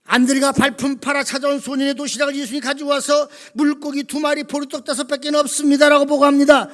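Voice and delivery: loud, driving sermon retelling, pitch held up; full-force preaching. Real, not acted. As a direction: belt out the Bible story without letting up.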